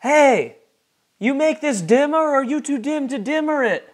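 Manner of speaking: high, loud, insulting demand; Sammy's angry outburst. Acted. Sammy berating the card blaster operator, angry shouting. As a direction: yell it at an incompetent crew member. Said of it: "Sammy turns to the card blaster".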